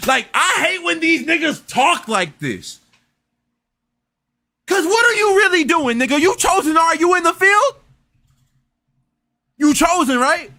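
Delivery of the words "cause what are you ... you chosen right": high-pitched, incredulous grilling; exasperated challenge. Real, not acted. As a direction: fire questions like you doubt every claim. Challenging those who claim chosen.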